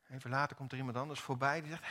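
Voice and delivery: ordinary delivery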